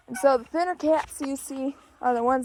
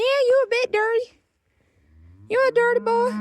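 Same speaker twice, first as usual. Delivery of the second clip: high teasing pet voice, louder; 11 semitones higher, 4 dB louder than usual. Real, not acted. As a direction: coo at the dog high and playful. Teasing dirty dog.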